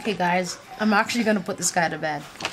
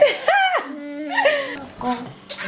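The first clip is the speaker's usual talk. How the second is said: breaks into a high giggle; laughing uncontrollably, high; high laughing fit; 12 semitones higher than usual. Real, not acted. Mom laughing hard.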